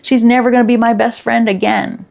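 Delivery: put-on upset little girl. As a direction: play the small girl complaining about her friend. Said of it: "She's crying".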